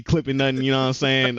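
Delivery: ordinary talk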